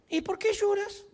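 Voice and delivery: a high-pitched, innocently curious angel; angel's question. Acted. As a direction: ask it in a high, light put-on voice. Angel questions weeping woman, acted.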